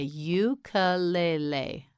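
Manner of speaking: "Ukulele" slowly, every syllable separate. Shown as a demonstration. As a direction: stretch out "Ukulele" for a learner. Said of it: "You say it. Say".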